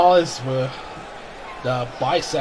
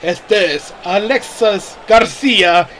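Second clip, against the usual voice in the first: lucha libre announcer; 4 semitones higher, 9 dB louder than usual. Acted. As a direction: stretch the names out for the crowd. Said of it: "El Cartel's personal ring announcer".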